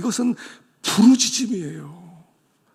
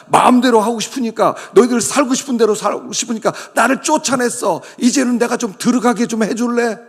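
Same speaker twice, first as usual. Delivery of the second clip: higher, louder hurt reproach; shut-out Lord; 6 semitones higher, 7 dB louder than usual. Acted. 문 밖에 쫓겨난 주님이 라오디게아 성도들에게, 높고 큰 서운한 호소. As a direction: plead from outside the door. Jesus locked outside pleads.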